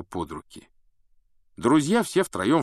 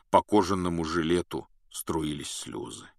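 ordinary delivery

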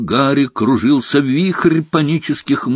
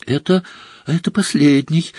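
halting, higher-pitched gasped words; breathless exclamation; 5 semitones higher than usual. A narrator's reading. Harry gasping that it is the last one, breathless exclamation. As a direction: blurt it out short of breath. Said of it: "задыхаясь, воскликнул Гарри".

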